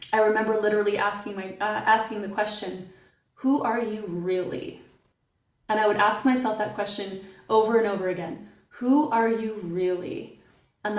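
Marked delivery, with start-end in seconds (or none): 3.3-4.8 slowed right down, each word weighed; slow searching question. Retold or imitated. To herself in the MRI machine, a slow searching question. 8.8-10.4 slowed right down, each word weighed; slow searching question. Retold or imitated. To herself in the MRI machine, a slow searching question.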